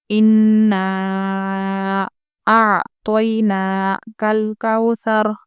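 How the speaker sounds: slow measured tajweed recitation with ghunna; Quran recitation. Shown as a demonstration. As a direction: recite slowly, holding the nasal sound. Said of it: "देखिए, इन में हम गुन्ना करेंगे".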